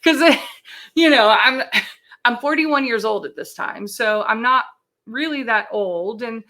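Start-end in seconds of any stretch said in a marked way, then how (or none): 0.3-0.9 short loud laugh; real laugh breaking into the story. Real, not acted. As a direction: burst out briefly mid-sentence. Laughs while telling own diagnosis.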